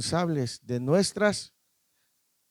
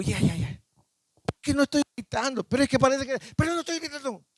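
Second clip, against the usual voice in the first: protesting he's not shouting; 8 semitones higher than usual. Retold or imitated. Preacher mimicking himself arguing with his wife, pitched high. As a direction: insist you're not yelling, voice climbing high. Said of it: "Y ya, le digo".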